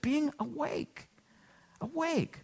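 soft, high, slow and drawn out; hushed emphasis. Real, not acted. Preacher urging people to be awake, hushed emphasis. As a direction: say it softly, letting the key word hang.

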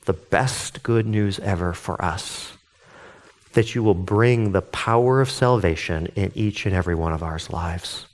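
soft, low prayerful voice. Acted. Pastor praying before Bible study, soft and low. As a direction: pray quietly over the group.

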